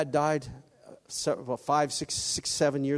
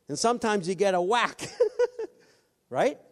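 laughing; 6 semitones higher, 5 dB louder than usual. Real, not acted. Laughing at getting whacked.

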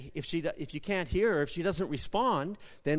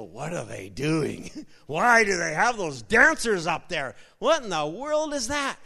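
mock disapproval; 4 dB louder than usual. Critics looking at the dancers up there, mock disapproval. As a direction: imitate a scandalized onlooker, building in indignation. Imitates critics of worship dancing.

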